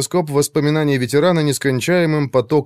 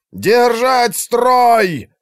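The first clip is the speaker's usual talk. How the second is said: higher, very slow barked command; gruff barked parade orders; 12 semitones higher, 4 dB louder, much slower than usual. A narrator's reading. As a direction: bellow it over the battle noise. Commanders' cries remembered.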